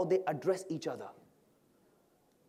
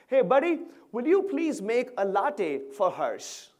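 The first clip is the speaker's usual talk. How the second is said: high, loud and polite call-out to a colleague; friendly barista order; 6 semitones higher, 6 dB louder, slower than usual. Acted. Server passing a customer's order to a colleague, friendly call. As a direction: sing out a courteous coffee order, stretched out. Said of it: "Now, that's how they talk".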